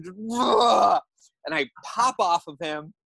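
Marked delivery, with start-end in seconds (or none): 0.3-1.0 mock roar of frustration, high and loud; loud, raised "ugh" venting frustration; frustrated primal scream. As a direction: imitate a primal scream, held back a little. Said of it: "just out of frustration".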